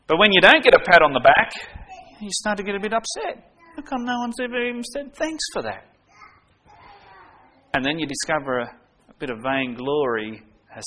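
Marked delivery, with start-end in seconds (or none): 3.7-5.8 higher, softer, hurt "how come" complaint; aggrieved grumble. Retold or imitated. A church volunteer griping that nobody thanked him, in a higher, softer, hurt voice. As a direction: sulk about never being thanked. Voicing an unthanked person's grumble.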